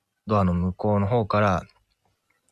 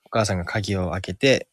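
ordinary talk

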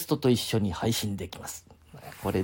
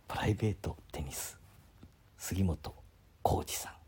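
whisper; 6 semitones lower, 12 dB quieter than usual. Real, not acted. Reading name quietly.

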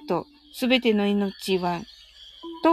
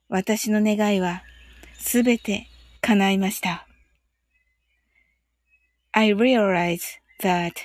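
guided meditation; 3 semitones lower, much slower than usual. Acted. Reads meditation phrases.

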